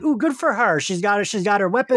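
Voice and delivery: high, cooing approval; delighted reaction. Retold or imitated. Host cheering on the old lady, delighted. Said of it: "I was like".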